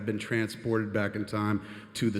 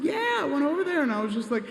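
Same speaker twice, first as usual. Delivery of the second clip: much higher, breathless fan voice; giddy excitement; 13 semitones higher than usual. Retold or imitated. Telling his friend, very high and giddy. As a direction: squeal it like a starstruck kid. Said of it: "I was like".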